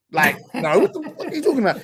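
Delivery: high-pitched mock indignant voice; rapid, squeaky comic disbelief; Michael. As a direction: imitate an incredulous star refusing, voice shooting up high. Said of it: "He's like".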